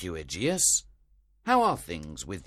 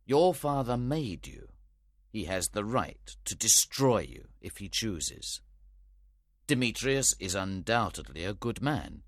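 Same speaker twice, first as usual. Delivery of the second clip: stern; 2 semitones higher, 3 dB quieter than usual. A narrator's reading. Theseus warning Hermia, stern. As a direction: warn her like a strict judge. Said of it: "he said sternly".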